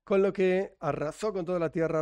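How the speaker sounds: usual speaking voice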